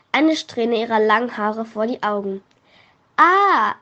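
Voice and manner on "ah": high, loud shocked cry; sudden scream. A narrator's reading. Tabea sees her hair change, crying out. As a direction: let out a startled yelp. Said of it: "entfuhr ihr ein Aufschrei".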